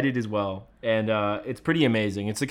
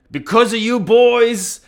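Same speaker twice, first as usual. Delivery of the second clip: higher, drawn-out imitation of the actor; Mister De Niro; 8 semitones higher, 4 dB louder, much slower than usual. Podcaster retelling the actor's backstage speech, slow imitation. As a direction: stretch it out slowly, pitched up. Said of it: "and he said".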